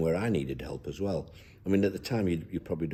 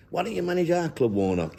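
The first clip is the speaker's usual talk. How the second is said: a higher, hammed-up Welsh accent; 11 semitones higher, 3 dB louder than usual. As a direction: call it out like a cheery Welsh fan imitated. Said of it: "In his Welsh accent".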